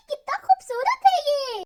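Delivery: loud, high, laughing admiration; delighted praise. Acted. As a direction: gush over the beautiful city. Queen admiring the city.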